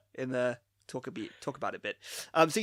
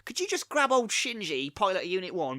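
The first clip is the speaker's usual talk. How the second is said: high, chirpy mock phone request; breezy by-the-way errand call, hammed up; casual phone caller; 4 semitones higher than usual. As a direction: toss it off like a trivial favour. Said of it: "You know, it's like".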